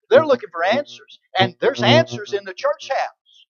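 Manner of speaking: loud, driving preacher's call; full-force preaching. Acted. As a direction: preach it hard to the congregation.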